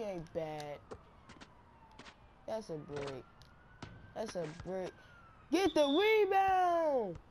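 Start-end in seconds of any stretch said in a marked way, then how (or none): 5.5-7.2 long, drawn-out yell, much higher and louder; shouting. Real, not acted. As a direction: bellow at your teammate to grab the board. Yelling at teammate in game.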